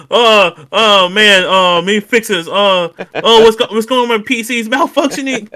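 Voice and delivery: loud, high-pitched mock panic; retold freak-out. Gamer retelling his PC failing mid-game, loud mock freak-out. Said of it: "I was freaking out".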